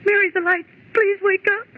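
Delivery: anxious pleading calls in the dark; frightened pleading. Acted. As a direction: beg her to wake up, scared. Frightened woman wakes her friend.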